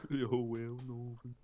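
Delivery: drawn-out put-on northern voice, slow; mocking accent. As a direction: stretch each word in a silly accent. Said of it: "Why do we always go on to accents".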